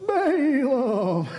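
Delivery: high, put-on donkey voice calling Balaam's name. Pastor voices the talking donkey for the congregation, high and put-on. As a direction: squeak the name like a cartoon donkey. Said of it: "doing the whole dialogue in my Wilbur voice".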